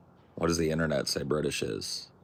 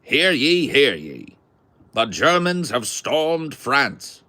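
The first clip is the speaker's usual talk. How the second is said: loud, slow transatlantic newsreel announcer, pitched up; old radio announcer; 7 semitones higher, 8 dB louder, slower than usual. Retold or imitated. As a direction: boom it out like a 1940s radio bulletin. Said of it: "old forties or thirties radio announcer".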